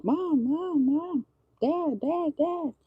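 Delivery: put-on high, whiny kid calling over and over; child calling for a parent. Kid interrupting a parent's five minutes, high nagging call. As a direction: pester like a kid who wants attention. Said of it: "picture your child coming in".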